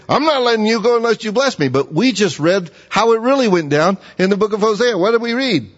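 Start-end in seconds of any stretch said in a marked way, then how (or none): 0.1-1.7 higher, fast, bossy demand; demanding, in command. Shown as a demonstration. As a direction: say it like he holds all the power. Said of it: "it sounds like he's in command".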